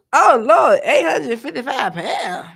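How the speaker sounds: loud laughing exclamation; loud amazed laugh; laughing shock. Real, not acted. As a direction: burst out laughing in disbelief. Shocked by manatee weight.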